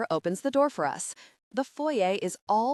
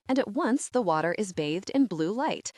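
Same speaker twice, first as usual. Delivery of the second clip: ordinary delivery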